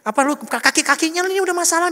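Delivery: loud, high pestering complaint; nagging passenger. Acted. Relative nags Dre about the car's worn parts, loud nagging. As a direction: nag loudly in comic alarm.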